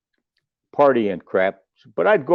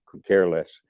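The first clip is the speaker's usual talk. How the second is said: usual speaking voice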